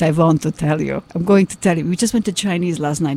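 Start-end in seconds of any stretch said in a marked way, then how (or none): 0.0-1.8 Hungarian father sounding like Dracula; Hungarian Dracula accent. Retold or imitated. Daughter showing how her Hungarian father sounds, Dracula accent. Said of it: "he sounds like this".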